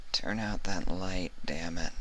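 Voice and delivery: a slow, soft, sleepy grumble; groggy moan. A narrator's reading. Vinyl grumbling at the light, groggy. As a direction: mutter it half asleep. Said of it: "she moaned".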